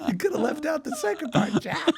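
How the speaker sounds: talking through real laughter; amused, half-laughing complaint. Real, not acted. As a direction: laugh while protesting. Laughing at the trainer's insult.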